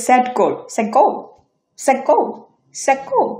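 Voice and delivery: "set goal" as sek, a catch, then goal; glottal stop. Shown as a demonstration. Teacher models a bump, "set goal" as sek-goal. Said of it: "একটা ধাক্কা খেয়ে পরে".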